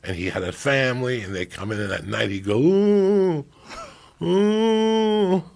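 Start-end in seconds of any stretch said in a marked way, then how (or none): 2.6-5.4 put-on groaning old man. Imitating groaning sick roommate.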